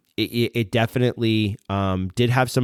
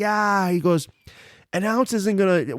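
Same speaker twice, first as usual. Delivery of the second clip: high-pitched imitation, waving it away; dismissive brush-off; 11 semitones higher than usual. Store owner dismissing the cost of an ounce, high brush-off imitation. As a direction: lightly mimic a host shrugging off the cost.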